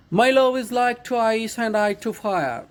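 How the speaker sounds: slow, drawn-out reading of a poem line; measured, drawn-out poem reading; slow poem recitation. Shown as a demonstration. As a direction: recite each word slowly to the class. Teacher recites poem line again.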